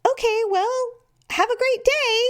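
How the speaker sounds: high breezy brush-off voice, far above her own; chirpy receptionist. Retold or imitated. Woman mimicking the nurse brushing her off, high and airy. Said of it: "she said".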